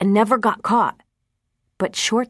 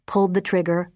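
ordinary delivery, nothing marked

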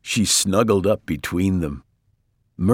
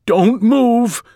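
high, loud warning; alarmed shout; 6 dB louder than usual. A narrator's reading. Mister Watson warning his family in the sinking bed, urgent shout. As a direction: shout to freeze everyone. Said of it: "shouted Mister Watson".

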